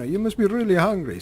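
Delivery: high mock Ukrainian mother; attempted Russian-Ukrainian accent. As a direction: fuss over guests in a rough Russian accent. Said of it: "I can't do a Russian stroke Ukrainian accent".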